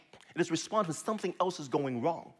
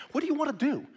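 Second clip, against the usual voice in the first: asked high and bright, the word you leaned on; high questioning; 10 semitones higher, 3 dB louder, faster than usual. Shown as a demonstration. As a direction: pitch the question up high as if asking the angry self. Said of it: "What kind of result do you actually want?".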